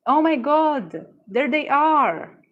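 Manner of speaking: high, loud, drawn-out adoring cry; stretched-out, elated fangirl exclaiming; gushing delight. Real, not acted. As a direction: swoon out loud at finding your darling. Gushing on spotting beloved card.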